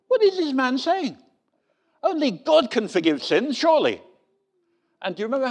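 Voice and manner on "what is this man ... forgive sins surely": high, indignant objection, a bit louder; indignant grumbling. Retold or imitated. Preacher voicing offended onlookers at the healing, indignant and high. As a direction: scoff like an offended scribe. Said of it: "they started muttering to themselves".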